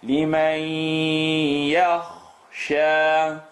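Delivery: لِمَنْ يَخْشَى sung with the noon merged into a doubled ya. Shown as a demonstration. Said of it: "put a shadda on top of the ya".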